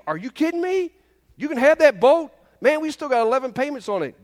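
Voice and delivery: high, loud, half-joking disbelief; tossing away the boat with a laugh in the voice; mock incredulous follower. Follower handing over his boat, pretend exasperation.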